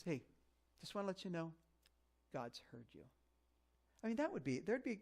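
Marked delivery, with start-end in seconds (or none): 0.0-3.0 soft reassurance. Pretend. Imagined gentle message from God.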